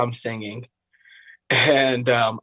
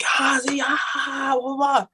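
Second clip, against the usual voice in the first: high-pitched mock crowd cheer; thin, high pretend scream; imitated cheering; 12 semitones higher than usual. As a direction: mimic a crowd going wild for you. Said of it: "everyone starts cheering".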